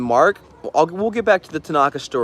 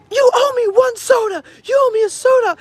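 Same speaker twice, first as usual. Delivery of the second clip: shrill, much higher yelling, near crying; screaming girl; 6 dB louder than usual. Retold or imitated. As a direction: scream it like she's been cheated. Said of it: "this girl walks up and she's like".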